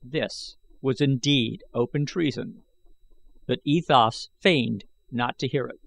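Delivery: plain reading voice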